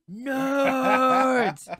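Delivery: huge, high, drawn-out holler; playful full-volume heckle, one long stretched word; yelling at people. Pretend. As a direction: bellow it across a convention crowd in mock taunt. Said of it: "so I can yell at people".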